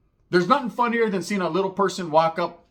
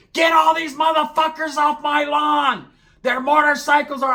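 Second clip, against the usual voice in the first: high, loud, drawn-out scolding shout; imitated angry mother screeching; yelling mom; 11 semitones higher, 5 dB louder, slower than usual. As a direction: holler at them to clear off your lawn. Retold mom yelling from house.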